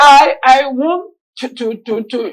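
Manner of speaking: slow, halting repeats; imitated stutter. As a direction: stall on the small word, voice sinking. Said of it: "when this person is struggling".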